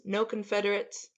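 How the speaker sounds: ordinary delivery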